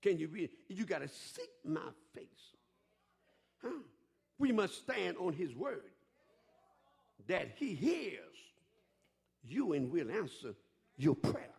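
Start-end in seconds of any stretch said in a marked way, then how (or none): none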